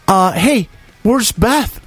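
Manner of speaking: high-pitched imitation of Nikki, breezy and clueless; high mock woman. Storyteller mimics the party host arriving early, high and breezy. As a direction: squeak it out like a ditzy party host.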